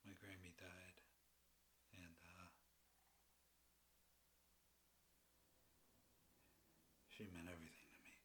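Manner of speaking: very soft, halting confession; quiet grief. Acted. Grieving a grandmother's death.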